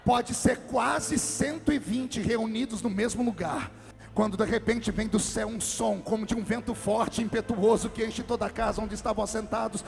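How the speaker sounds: booming preacher climbing to a sung cry; preaching at full force. Acted. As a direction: proclaim it to the whole hall. Preaches Pentecost passage at full force.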